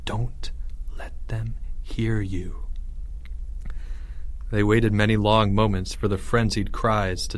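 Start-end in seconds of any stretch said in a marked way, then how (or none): 0.0-2.6 slow, very soft whispered warning; hissed whisper. A narrator's reading. Leo warns his soldiers in hiding, hissed whisper. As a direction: whisper it word by word. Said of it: "Leo hissed".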